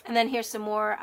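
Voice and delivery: ordinary delivery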